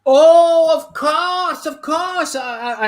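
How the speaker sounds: very high, falsetto apology; high-pitched apology. Acted. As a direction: squeak it out, flustered. Butcher suddenly apologetic.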